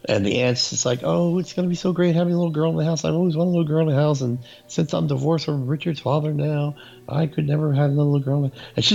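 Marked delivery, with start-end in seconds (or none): 1.0-8.6 high, fast, overexcited aunt voice; gushing aunt. Retold or imitated. Eccentric aunt welcoming Angela into the house, gushing. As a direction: gush in a raised, hurried voice.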